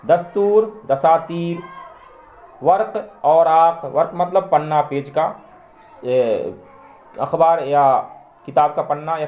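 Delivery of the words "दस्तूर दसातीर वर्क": ordinary delivery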